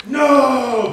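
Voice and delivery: high loud panicked no; alarmed no. Retold or imitated. Partner yelling as he reaches into the trap. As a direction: yell no as the arm goes. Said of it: "he went".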